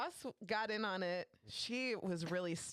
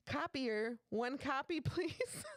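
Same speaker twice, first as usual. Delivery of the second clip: sweet request; 9 semitones higher, slower than usual. Retold or imitated. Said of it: "she's like".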